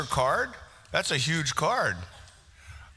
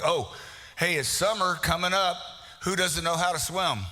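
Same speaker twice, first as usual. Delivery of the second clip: usual speaking voice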